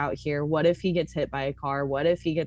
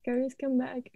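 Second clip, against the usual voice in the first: very high, soft mock plea; tiny squeaky call, fading away; playful calling; 8 semitones higher, 5 dB quieter than usual. Playfully calling absent co-player back.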